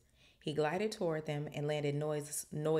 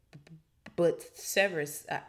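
nervous stuttering; 4 dB louder than usual. A narrator's reading. Quirrell protests to Snape in the forest, stuttering. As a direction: stammer on the b before getting the word out. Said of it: "he was stuttering worse than ever".

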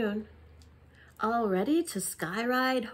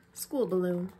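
usual speaking voice

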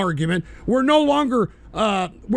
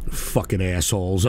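ordinary talk

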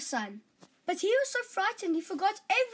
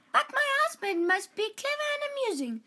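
high, proud princess voice; haughty princess; 7 semitones higher than usual. Acted. Princess announcing her demands, proud and high. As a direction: speak as a royal princess laying down terms.

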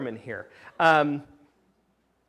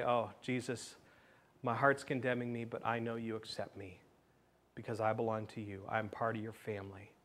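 quiet prayer; 5 semitones lower, 10 dB quieter than usual. Pretend. Heart's quiet prayer to Jesus.

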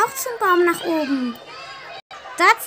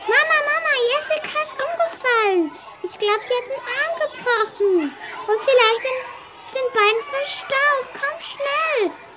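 put-on urgent child calling; 6 semitones higher than usual. Child calls mother urgently.

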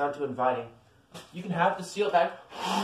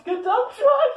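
high, loud excited squeal; excited shout; 8 dB louder than usual. Real, not acted. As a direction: squeal it out with glee. Excited toy showing.

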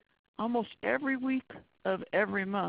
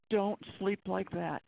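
ordinary delivery

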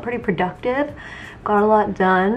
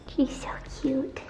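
soft hushed whisper; 6 semitones higher, 9 dB quieter than usual. Real, not acted. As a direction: whisper so the cat stays asleep. Cat sleeping nearby.

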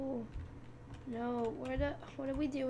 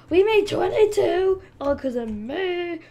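much higher, loud celebration; thrilled loud cheer; excited cheer; 7 semitones higher, 12 dB louder than usual. Real, not acted. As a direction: shout the score in triumph. Celebrating cube count.

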